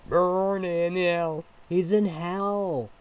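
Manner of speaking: furious slow condemnation; angry curse. Real, not acted. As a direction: spit the damnation out slowly and hard. Condemning Hitler and the Nazis.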